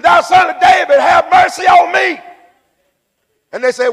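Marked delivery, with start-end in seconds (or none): 0.0-2.2 high, loud shouted plea; crying out the more. Acted. Blind man told to hold it down, crying out more. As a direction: shout it out over those hushing you. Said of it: "he cried out the more".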